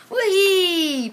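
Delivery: high, loud, laughing whee; gleeful whee. Acted. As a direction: squeal with joy as they splash. Seals jump into water.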